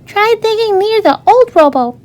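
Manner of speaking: very high, squeaky call; little fish calling. A narrator's reading. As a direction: call out helpfully like a small fish. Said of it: "Flounder called".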